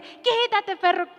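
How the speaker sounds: high, loud snap at the dog; angry son. Retold or imitated. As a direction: shove the dog off with a yell. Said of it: "ve al perro y le dice".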